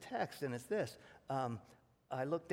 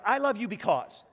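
louder mock declaration of family love; emphatic quote; 3 semitones higher, 8 dB louder than usual. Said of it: "but it's like".

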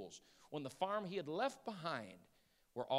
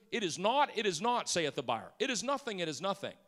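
buyer's dismissal; 5 semitones higher, 7 dB louder than usual. Retold or imitated.

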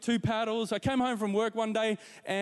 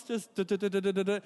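bumpy da da; faster than usual. Retold or imitated. Bumping over grass on mower.

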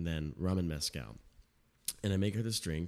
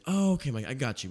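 higher, louder mock-delighted guest; imitating eager customer; 5 semitones higher, 5 dB louder than usual. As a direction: play the pleased customer, bright and loud. Customer voiced in the story.

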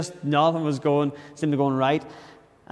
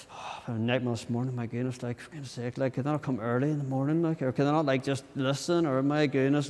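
grumbling aside; 6 dB quieter than usual. Retold or imitated. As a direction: moan quietly behind someone's back. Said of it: "you walk away to somebody else, you're like".

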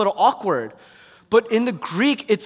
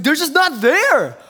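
higher, louder and slow with alarm; retold panic; 7 semitones higher, 6 dB louder, slower than usual. Telling the bride the boys vanished, alarmed. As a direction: spell out the alarm slowly. Panicked wedding, missing groomsmen.

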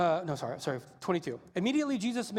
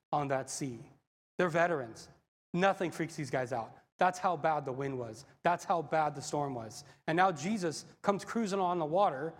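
ordinary delivery

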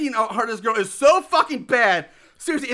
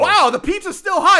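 high, loud amazed exclamation; mock amazed; 8 semitones higher, 7 dB louder than usual. Jason amazed at the fast pizza, high and loud. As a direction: gush in exaggerated surprise. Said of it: "even Jason's like".

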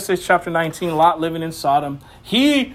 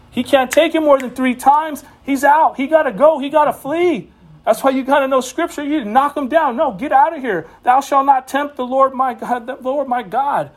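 fired-up sermon climax; preaching at full force; 6 semitones higher than usual. Acted. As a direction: drive it home from the pulpit.

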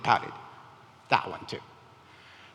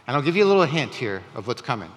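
ordinary talk, nothing marked

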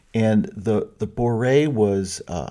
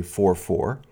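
usual speaking voice

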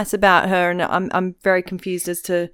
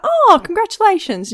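high-pitched, hammed-up host; high mock congratulations; 14 semitones higher, 4 dB louder than usual. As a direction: gush a fake congratulations. Host voice in retold chat.

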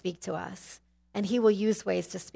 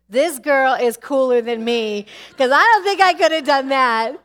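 admiring exclamation; 4 semitones higher, 11 dB louder than usual. Retold or imitated. Admiring reaction to woman's story.

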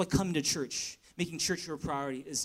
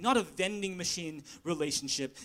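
ordinary talk

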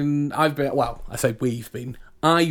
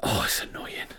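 breathy half-whisper of irritation; whispered grumble; 8 dB quieter than usual. Real, not acted. Fed-up host whispering her annoyance at Die Hard.

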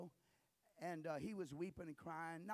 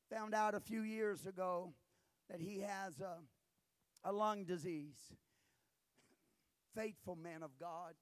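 ordinary talk, nothing marked

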